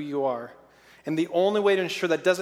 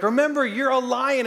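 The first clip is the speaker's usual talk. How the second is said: encouraging reminder; 7 semitones higher, 4 dB louder than usual. Retold or imitated. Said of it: "I'm like".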